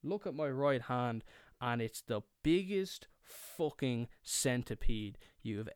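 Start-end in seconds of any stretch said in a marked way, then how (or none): none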